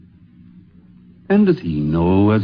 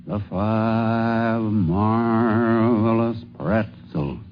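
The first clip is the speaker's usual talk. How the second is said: very slow, drawn-out circus announcer call; megaphone announcer; much slower than usual. Acted. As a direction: stretch every word like a ringmaster over a crowd. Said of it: "the big musical megaphone calls".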